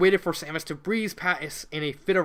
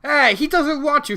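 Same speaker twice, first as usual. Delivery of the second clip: loud, higher yell after them; 9 semitones higher, 7 dB louder than usual. Acted. Girl yells after the fleeing boys. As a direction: call out sharply to stop them. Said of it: "she yelled".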